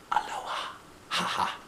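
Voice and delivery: soft breathy whisper to sleepy daddy. Acted. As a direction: whisper so as not to wake anyone. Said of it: "I whispered".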